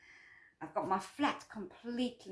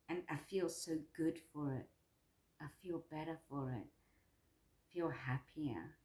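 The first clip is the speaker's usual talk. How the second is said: quietly moved; 2 semitones lower, 6 dB quieter than usual. Real, not acted. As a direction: let it really land, almost tearful. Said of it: "It's just really hit me".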